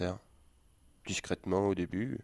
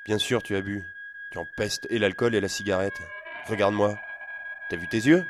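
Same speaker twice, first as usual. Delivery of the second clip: loud scolding, getting louder; father scolding his drunk son, loud; angry father; 2 semitones higher, 8 dB louder than usual. Acted. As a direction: rub his nose in it.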